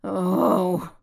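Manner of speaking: pained needy groan. Acted.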